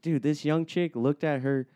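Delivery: ordinary talk, nothing marked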